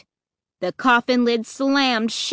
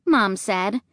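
ordinary delivery, nothing marked

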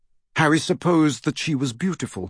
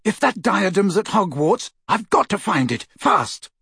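urgent, raised pitch; fierce; 7 semitones higher than usual. Acted. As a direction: insist fiercely, time is running out. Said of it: "he said fiercely".

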